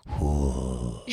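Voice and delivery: a breathy porn-dub moan, half joking; hammed-up whoa of heavy breath; mock heavy breathing. Shown as a demonstration. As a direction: sigh it out like dubbing a French film. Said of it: "like that".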